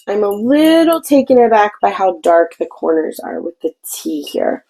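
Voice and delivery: ordinary talk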